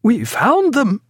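high, slow triumphant cry. A narrator's reading. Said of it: "cried Martin".

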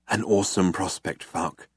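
low, soft, solemn reply; hushed awe. Acted. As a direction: murmur it reverently to your colleague. Awed man awaiting the computer's answer.

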